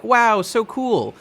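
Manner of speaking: high, drawn-out awed wow; awestruck. Acted. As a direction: marvel loudly like an impressed fan. Acting out two characters' awe at transformation.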